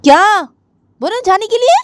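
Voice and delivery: put-on angry girl. Jiya telling Adi to get out, angry. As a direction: throw him out of the room.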